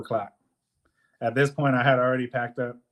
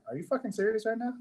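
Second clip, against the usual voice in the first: higher, disbelieving; incredulous question; 10 semitones higher, 6 dB quieter, faster than usual. Retold or imitated. Mover confronting the helper about quitting, incredulous. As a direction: ask it in disbelief. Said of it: "I'm like".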